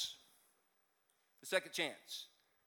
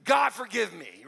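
slow, louder plea for forgiveness; mock plea; 8 dB louder, slower than usual. Sinner begging God after hitting send, slow loud plea. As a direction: plead it out, drawn out. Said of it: "and go".

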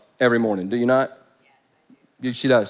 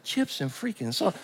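muttered disbelief; 11 dB quieter than usual. Retold or imitated. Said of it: "I was like".

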